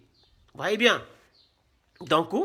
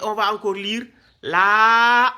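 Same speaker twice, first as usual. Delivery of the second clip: drawn-out reading demo; 5 dB louder, slower than usual. Shown as a demonstration. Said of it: "poser le coupon sur le ya".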